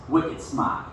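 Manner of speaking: a light Boston accent on "wicked smart". Shown as a demonstration. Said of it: "That was my best Boston accent".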